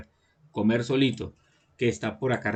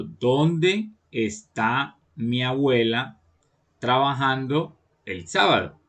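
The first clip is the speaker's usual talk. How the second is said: very slow, drawn-out reading with pauses; "¿Dónde" and "está" stretched out slowly, pausing before "trabajando"; slow dictation pace; slower than usual. Shown as a demonstration. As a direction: spell the question out phrase by phrase for pupils. Teacher reads the example slowly, then repeats.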